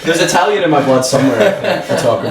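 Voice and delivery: laughing. Real, not acted. Laughing at Italian hands remark.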